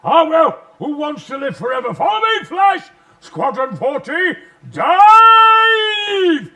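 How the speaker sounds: roaring, rousing war shout; rally the troops at full roar; heroic battle cry. Retold or imitated. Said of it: "I just said".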